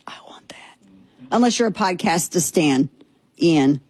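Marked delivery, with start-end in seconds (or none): 0.1-0.8 whispered longing; mock whisper. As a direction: breathe it like a secret wish. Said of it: "you're like".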